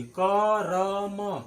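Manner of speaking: ordinary talk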